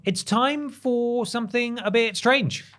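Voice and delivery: high, slow segment intro; announcer voice. Acted. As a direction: announce it like a show host.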